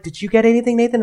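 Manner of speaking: high, eager question; eager friend. Retold or imitated. Mimicking a friend asking Nathan about the call, high and eager. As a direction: ask it like you can't wait.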